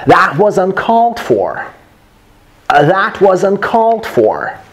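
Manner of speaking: "That was uncalled for" high, then a steep fall; indignant-style tune on "That was uncalled for"; natural, expressive delivery. Shown as a demonstration. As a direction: keep "uncalled" high and let "for" drop. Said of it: "say it naturally".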